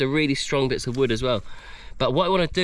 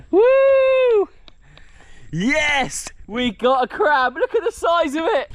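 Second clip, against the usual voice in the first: high, whooping celebration; triumphant excitement; 13 semitones higher, 4 dB louder, much slower than usual. Real, not acted. Finally holding the crab he caught, triumphant. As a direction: crow with delight, voice way up.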